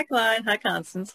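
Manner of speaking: usual speaking voice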